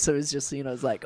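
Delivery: ordinary talk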